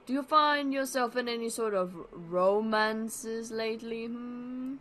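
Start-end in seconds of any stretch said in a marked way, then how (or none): none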